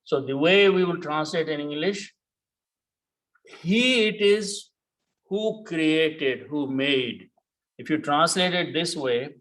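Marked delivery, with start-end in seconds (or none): 3.6-4.7 he it is, slow, stress on he; emphatic translation. Shown as a demonstration. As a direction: lean on he, slow and weighty: he it is. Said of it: "to bring the emphasis".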